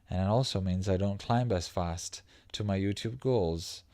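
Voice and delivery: ordinary delivery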